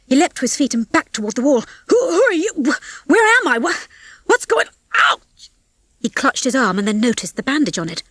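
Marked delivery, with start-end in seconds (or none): 1.9-5.5 high, stammering panic; panicked. A narrator's reading. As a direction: stammer out questions in alarm. Waking in panic among strangers.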